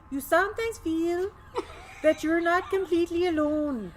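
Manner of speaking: put-on high, loud Swedish accent with a laugh. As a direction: quote the queen in a hammy Swedish accent. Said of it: "should I do the Swedish accent?".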